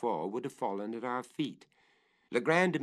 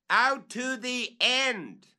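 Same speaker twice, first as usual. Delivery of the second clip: much higher, louder, very slow repeat; slow echoing holler; drawn-out call; 8 dB louder, much slower than usual. Acted. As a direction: stretch the words out, calling down.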